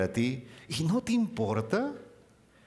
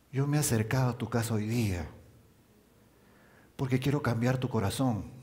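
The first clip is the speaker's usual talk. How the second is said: tender diminutives. Acted. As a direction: call each name fondly. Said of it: "qué le estaba queriendo decir". (Cristo llamando con cariño a sus hijos)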